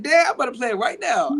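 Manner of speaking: bubbling over with happy laughter; excited laughing reply. Retold or imitated. Answering dad's request, laughing.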